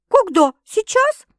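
very high, loud startled questions; astonished. A narrator's reading. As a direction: fire off two amazed questions. Sister startled by the confession. (изумлённо, высоко и громко)

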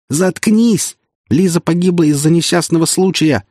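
high, loud angry shout; furious shout. Acted. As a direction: shout him down. Prince shouts down Gavrila.

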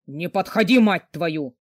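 low, angry giant's warning; menacing growl. A narrator's reading. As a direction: growl a threat to keep her back. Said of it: "грозно прорычал злой великан".

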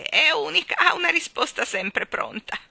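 high, loud chirpy musing; warbling to oneself. Acted. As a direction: remark to yourself like a chattering bird. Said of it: "osservò con un gorgheggio tra sé e sé".

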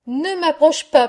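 playful scolding cry; mock shout. A narrator's reading. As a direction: shout it teasingly at the muddy child. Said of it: "lui criait sa mère".

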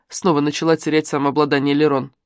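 plain reading voice

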